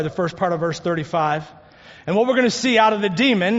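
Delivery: ordinary talk, nothing marked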